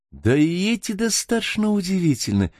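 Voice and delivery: high-pitched, lightly voiced exclamation; exclaiming. A narrator's reading. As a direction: exclaim it, pitched well above the narration. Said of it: "воскликнула Гертруда". (восклицая, высоким голосом)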